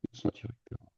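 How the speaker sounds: hushed, voiceless aside; barely audible whispered thought; whispering to self. Real, not acted. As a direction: whisper it as you check your own work. Checking a doubt to himself.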